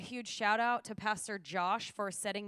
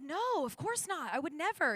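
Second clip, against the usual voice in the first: protesting innocence; 7 semitones higher than usual. Retold or imitated. Traveler denying intent to airport officer, high-pitched protest. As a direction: deny it emphatically, pitch jumping up.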